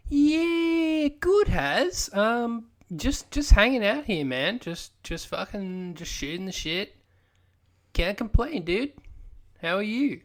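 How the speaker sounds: mild mock stoner drawl, lazy and loose; quasi stoner accent. Podcast cohost greeting the host in a half-hearted stoner accent. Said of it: "that quasi fucking stoner accent".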